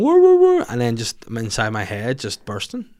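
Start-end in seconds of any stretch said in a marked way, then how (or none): 0.0-0.6 very high, loud woo woo howling; dog howl imitation. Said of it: "a dog just going".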